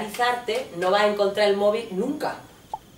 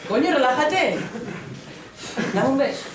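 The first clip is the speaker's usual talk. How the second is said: exasperated raised voice; shouted demand; 5 semitones higher than usual. Acted. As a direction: bark orders at your partner. Ordering partner to sit and kiss.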